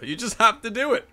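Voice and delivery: normal talking voice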